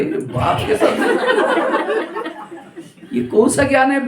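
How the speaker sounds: बहुत ऊँची, धीमी हैरान आवाज़ में "लव?"; चौंककर बहुत ऊँचे स्वर में पूछो; shocked newcomer. Retold or imitated. (नया आदमी चौंककर ऊँची आवाज़ में पूछता है)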